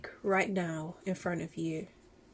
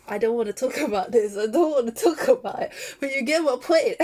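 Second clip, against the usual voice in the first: louder, laughing while fighting tears; laughing through tears; 3 semitones higher, 8 dB louder than usual. Real, not acted. Vegan laughs off getting emotional about pigs, loud laughing. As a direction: laugh it off, close to crying. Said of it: "oh, I'm gonna cry".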